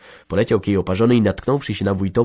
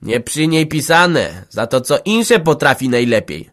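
slow, higher spiteful sneer; defiant jab; 5 semitones higher, 5 dB louder, slower than usual. Acted. Village head's wife sneering at Jagna, spiteful. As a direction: sneer at the girl. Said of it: "syknęła wójtowa wyzywająco".

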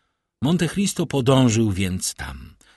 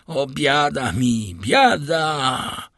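slow, raised cry of woe; anguished lament; 4 semitones higher, 3 dB louder, much slower than usual. Acted. Villefort recognising his mortal enemy, anguished lament. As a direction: wail it out in despair.

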